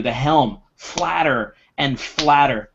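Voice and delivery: ordinary delivery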